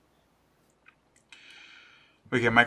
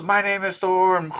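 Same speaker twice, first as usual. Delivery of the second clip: high, drawn-out superhero proclamation without real volume; held-back pretend yell, high and slow; mock yell; 6 semitones higher, slower than usual. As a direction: stretch it out like a hammed-up battle cry. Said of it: "that's when she yells".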